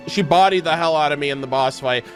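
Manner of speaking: usual speaking voice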